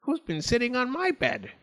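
high, indignant mock howl; howling Mummy Bear. A narrator's reading. Mummy Bear finds her bed disturbed, mock howl. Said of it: "Mummy Bear howled".

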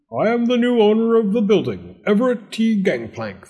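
high, loud, declaiming landlord; self-important landlord. Acted. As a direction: announce yourself grandly as the new owner. New landlord grandly introduces himself.

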